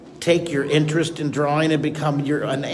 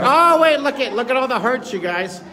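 excited; 7 semitones higher than usual. Real, not acted. Viewers flooding hearts.

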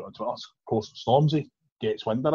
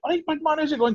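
put-on Scottish accent. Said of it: "obviously he didn't say it in a Scottish".

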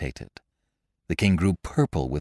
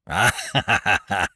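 tyrant's booming mock guffaw; roaring laugh. A narrator's reading. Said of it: "roared the latter".

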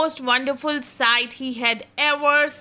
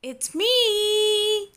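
high little-fish voice calling to friends; hopeful little fish calling friends; Sterling calling out; 8 semitones higher, much slower than usual. Acted. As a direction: call out brightly as a small fish. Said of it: "he called to his friends".

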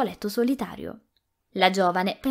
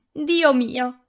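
louder cry on the edge of tears; near sobbing; 5 semitones higher, 5 dB louder than usual. A narrator's reading. Rossella crying out in pain, almost sobbing. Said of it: "esclamò Rossella quasi singhiozzando".

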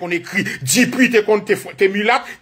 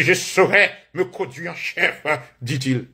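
mock-pompous recital; 6 semitones lower, 3 dB quieter than usual. Mock recital of French history quote.

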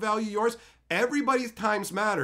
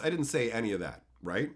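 normal talking voice

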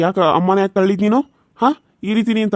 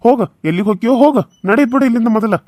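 fast, raised and higher; angry dismissal; 4 semitones higher, faster than usual. Acted. Husband angrily orders his wife out of the house. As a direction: snap at her to get out.

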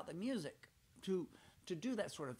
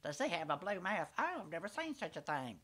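higher, louder fussy lady; Miss Sally Lynette voice; 4 semitones higher, 4 dB louder than usual. Retold or imitated. Miss Sally Lynette frets over the boy's blue mouth, higher and fussy. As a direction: fret like a prissy visiting lady. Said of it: "Miss Sally Lynette's the kind of person".

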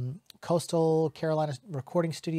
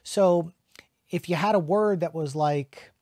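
usual speaking voice

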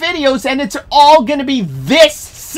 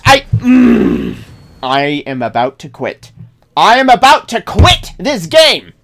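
ordinary delivery

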